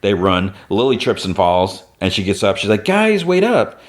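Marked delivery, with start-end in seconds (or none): 2.9-3.8 retold yell. Lily falls and calls to her friends, high mock yell. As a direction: call out pleadingly in a raised pitch. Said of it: "yelling for them to wait up".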